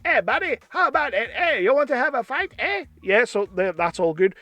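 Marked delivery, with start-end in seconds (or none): 0.0-2.9 high cartoon Canadian accent; mock Canadian picking a fight; Terrence and Phil. South Park duo asking for a fight, high Canadian accent. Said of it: "you just do Terrence and Phil".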